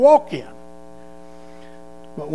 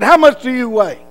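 drill sergeant yelling; 5 semitones higher, 8 dB louder than usual. Retold or imitated. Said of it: "He said".

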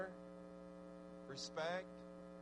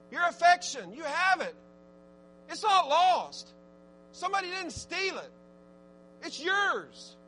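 1 impassioned preaching; 8 semitones higher, 7 dB louder than usual. Acted. Preaching at full force.

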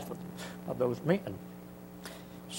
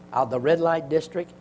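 ordinary delivery

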